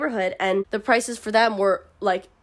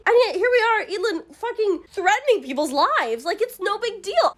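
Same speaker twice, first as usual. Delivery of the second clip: exasperated; 8 semitones higher than usual. Real, not acted. Ranting about threats of execution.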